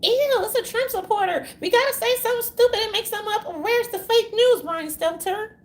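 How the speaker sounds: a hammed-up disdainful newsman voice, high and loud; mock Anderson Cooper. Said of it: "he's like".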